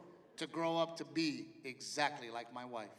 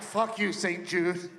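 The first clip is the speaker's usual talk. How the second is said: mock tearful outburst; 3 dB louder, much slower than usual. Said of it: "now I cry at commercials".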